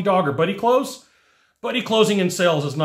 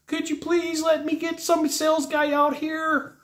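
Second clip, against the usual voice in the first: high, whiny pleading request; super whiny; 11 semitones higher, 4 dB quieter than usual. Retold or imitated. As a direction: beg for the sales guy like a child. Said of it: "It's how it sounds. It's super whiny".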